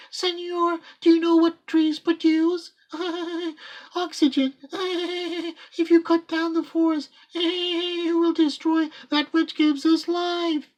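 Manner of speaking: high tittering porcupine voice; giggling porcupines. Acted. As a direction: chirp it out between giggles.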